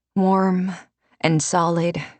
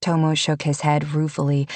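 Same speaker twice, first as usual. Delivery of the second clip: plain reading voice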